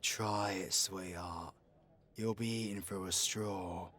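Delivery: low, soft menace; quiet threat. Acted. Outlaw warning someone after the bounty, quietly threatening. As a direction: warn them off very quietly.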